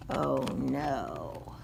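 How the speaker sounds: low, soft worried murmur. Acted. Narrator murmuring worry at the mess. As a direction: drop it low in dismay.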